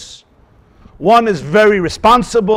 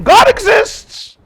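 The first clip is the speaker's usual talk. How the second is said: fervent proclamation; 14 semitones higher, 3 dB louder, much slower than usual. Real, not acted. Preaching climax.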